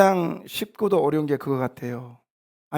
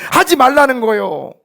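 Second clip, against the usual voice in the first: loud, high pulpit command; forceful command; 7 semitones higher, 8 dB louder than usual. Real, not acted. Pastor telling believers to stop gossiping, forceful command. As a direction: drive it home at full voice. Shouted take, then repeated calmly.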